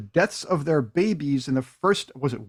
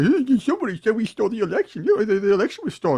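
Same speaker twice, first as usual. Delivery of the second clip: higher, hurried mock whine; whining; 5 semitones higher than usual. Host mocks a complainer about the election, whining. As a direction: imitate a whiny complainer, rushing the words. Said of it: "gonna whine and say".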